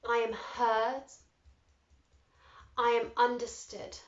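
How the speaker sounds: slow, deliberate "I am" statements; measured affirmation voice. Shown as a demonstration. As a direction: say each one for them to echo. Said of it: "repeat after me".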